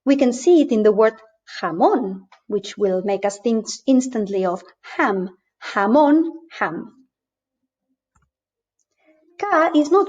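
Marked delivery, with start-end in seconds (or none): 1.6-2.3 jamón with a throaty "kh" and stressed last syllable; Spanish jamón, scraped J at the start; guttural Spanish J. Shown as a demonstration. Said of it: "always pronounced like this". 5.7-6.3 Jamón with throaty first sound, raised and louder than ham; Spanish Jamón against English ham; guttural Spanish J. Shown as a demonstration. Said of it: "always pronounced like this".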